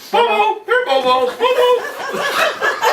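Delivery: very high, long calls, laughing through them; loudspeaker dog call. Retold or imitated. Deputy driving the road calling the dog on loudspeaker, high. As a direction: sing out the dog's name over a PA. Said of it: "He's blowing that loudspeaker".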